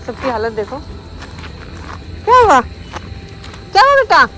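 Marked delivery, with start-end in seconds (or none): 2.2-4.2 high, slow cooing at the pet. Real, not acted. Owner coos high and slow at her worried dog.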